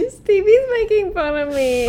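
laughing, half-crying complaint; whiny laughing. Real, not acted. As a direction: whine it through giggles. Mock-whining while laughing.

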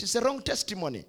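ordinary talk, nothing marked

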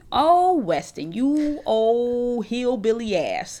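slow, drawn-out "Oh, Weston" with a laugh; mock him fondly, stretching it out; teasing mockery. Real, not acted. Updating friends on Love Island, slow teasing laugh. Mocking a reality show contestant.

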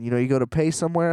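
usual speaking voice

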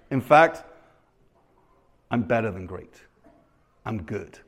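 low, soft attempt at a New York accent; halting, understated New York accent impression; [name]'s New York accent. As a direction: drop pitch and volume, lightly New York accent. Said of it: "I will try to do the New York accent".

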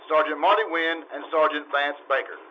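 measured, formal announcer cadence; announcer credits read. Acted. Announcer thanking the sponsor and crediting the cast, formal broadcast read.

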